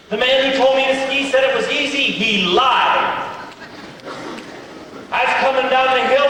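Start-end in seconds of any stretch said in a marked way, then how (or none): none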